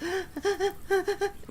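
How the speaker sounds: high little held-breath squeaky laugh bursts; breath-held gasping laugh. Shown as a demonstration. Streamer copying her own laugh for chat, gasping little bursts. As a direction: let out tiny high gasps of laughter. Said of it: "holding my breath and letting out little".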